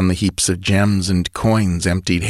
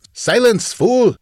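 high, raised snarl; slower than usual. Acted. Chief to bandit, angry snarl. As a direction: cut him off savagely. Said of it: "snarled the chief".

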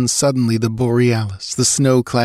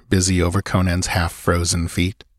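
plain reading voice

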